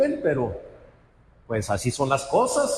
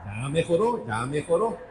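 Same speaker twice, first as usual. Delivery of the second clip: ordinary talk, nothing marked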